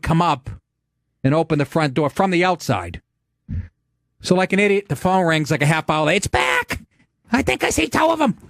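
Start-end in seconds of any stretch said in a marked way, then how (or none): 6.2-8.4 much higher, frantic wife voice; shrill alarm of a scared wife; panicked wife. Retold or imitated. Panicked wife calling on phone.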